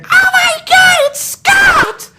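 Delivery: piercing, very high, loud fan squeal; screaming fan. Retold or imitated. Retelling fans spotting a famous actor, mock fan scream. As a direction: shriek like a starstruck fan. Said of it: "all of a sudden I hear".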